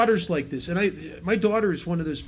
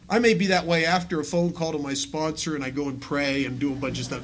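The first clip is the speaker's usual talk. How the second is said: usual speaking voice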